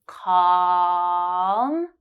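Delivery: "Calm" with the ah held three seconds. Shown as a demonstration. Teacher holding the ah in "Calm" for learners. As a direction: stretch the ah in "Calm" long. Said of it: "hold ah for three seconds for the first time".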